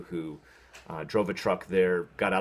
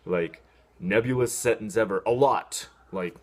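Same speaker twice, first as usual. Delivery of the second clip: usual speaking voice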